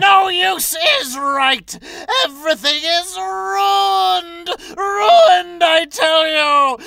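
high, slow anguished crying; despairing wail. Acted. Villain to Jones, wailing that all is ruined. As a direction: wail over the ruin.

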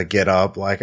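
usual speaking voice

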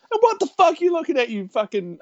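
high-pitched imitation of a confrontation; mock irate customer. Angry woman turns on an onlooker, mock aggression. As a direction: turn on a bystander in hammed-up outrage. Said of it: "she turns to me and she's like".

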